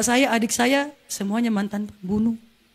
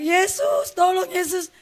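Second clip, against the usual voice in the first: high, drawn-out mock cry; reenacted high-pitched plea; retold cry for help; 8 semitones higher, slower than usual. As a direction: call out for rescue at normal volume, pitch way up. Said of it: "Pas saya teriak".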